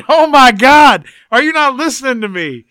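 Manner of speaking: loud, high, exasperated; exasperated outburst. Real, not acted. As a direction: blow up in disbelief. Exasperated at friend's pronunciation.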